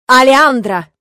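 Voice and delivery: loud, angry summons; calling out. A narrator's reading. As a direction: yell for the nanny. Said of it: "позвал няню отец".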